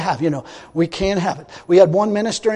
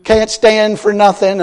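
normal talking voice

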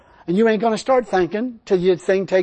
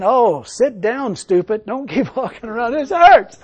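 complaining foot; 3 semitones higher, 5 dB louder, slower than usual. Acted. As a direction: play a protesting foot, drawn out at first, then crying out. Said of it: "my foot's saying".

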